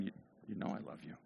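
hushed, quick admission; soft weary reply. Acted. Peter answers Jesus after being asked, soft and hurried. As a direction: say it softly and hurriedly, letting it fade. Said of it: "So Peter's probably like, "Ugh".

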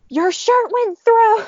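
high, slow, drawn-out excited yell; all-caps shout. Retold or imitated. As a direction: read out the text as an excited shout. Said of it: "in all caps".

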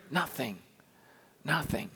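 quiet grave emphasis; hushed stark nothing. Real, not acted. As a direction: drop to a near-silent, heavy "Nothing". Healing prayer failed, stark.

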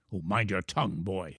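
low, hushed scolding; scolding father. A narrator's reading. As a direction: scold your son under control, softly. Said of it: "his father scolded".

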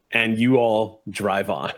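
normal talking voice

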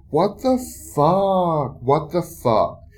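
loud, high, slow disbelief; What the fuck, loud and incredulous; real outrage. Real, not acted. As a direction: spit it out, stunned and furious. Outraged after street harassment.

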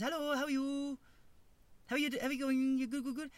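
high-pitched mock Korean-English accent. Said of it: "like, pretend English".

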